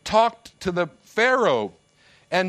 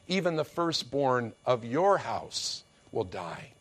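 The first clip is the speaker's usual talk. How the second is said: lower, softer, grave pronouncement; God's verdict; 8 semitones lower, 6 dB quieter than usual. Acted. God answering proud Pharaoh, low grave verdict. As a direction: speak it down low like final judgment. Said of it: "God says".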